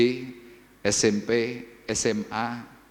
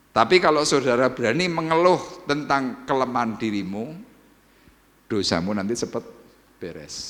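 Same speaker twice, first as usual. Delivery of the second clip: ordinary talk, nothing marked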